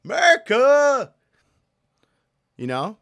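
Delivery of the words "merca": high loud whooping "Merca!"; hammed-up eagle cheer; patriotic yell. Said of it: "I just wanted to go".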